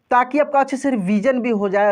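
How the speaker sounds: ordinary talk, nothing marked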